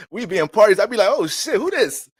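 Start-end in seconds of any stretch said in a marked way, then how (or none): 1.1-2.1 high-pitched, hammed-up surprise; acted party surprise. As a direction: react high, caught off guard by the music. Acting himself puzzled at party.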